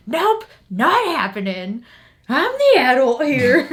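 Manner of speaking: loud, slow, laughing parent voice; stern parent shutdown. Retold or imitated. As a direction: boom out the firm parent line. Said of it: "and then you're just like".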